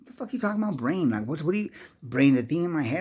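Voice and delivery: mock outrage, firing back questions; aggravated disbelief. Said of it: "start getting a little aggravated".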